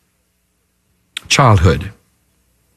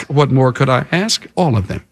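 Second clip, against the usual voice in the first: level retort. Acted. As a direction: answer drily, dropping the pitch right down. Answers Saul's excuse in lower voice.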